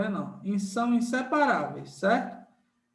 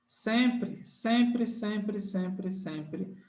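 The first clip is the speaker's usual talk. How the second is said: fading repetition; 4 dB quieter, much slower than usual. Shown as a demonstration. Teacher drumming the vowel rule into students, fading repetition. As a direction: repeat it like an echo dying away.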